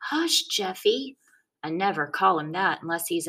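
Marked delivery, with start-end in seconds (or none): 0.0-1.2 put-on slow, higher hush to a hurt child; calm a crying little brother gently; big brother soothing a bleeding kid slowly. A narrator's reading. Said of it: "saying things to him over and over".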